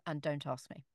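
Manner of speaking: ordinary delivery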